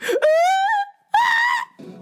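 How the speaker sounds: very high, loud shrieking mock scream; frightened scream. Vlogger imitating the scared driver's high shriek. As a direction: squeal like a startled driver. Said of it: "She's like".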